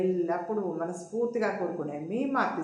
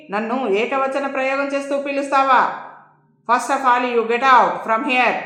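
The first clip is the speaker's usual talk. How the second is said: irate warden, moderately raised voice; worked-up scolding, storyteller style; acted rage; 6 semitones higher, 10 dB louder than usual. A narrator's reading. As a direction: order them out in a narrated temper. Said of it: "ఆవేశంతో ఊగిపోతూ అంది నీలమణి".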